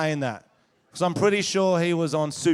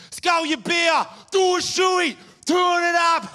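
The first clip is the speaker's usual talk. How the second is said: put-on much higher, louder drunken yells; heckler shouting; 12 semitones higher, 3 dB louder, slower than usual. Comic retelling a heckler's yells at his show. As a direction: bellow it like a rowdy drunk. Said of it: "He's heckling me".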